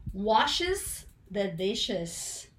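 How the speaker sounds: washes the dishes, muy lento y marcado; very slow demo. Shown as a demonstration. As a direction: alarga washes the dishes sílaba a sílaba. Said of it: "Yo digo". (frase de ejemplo dicha muy despacio)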